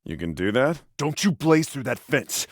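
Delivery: ordinary delivery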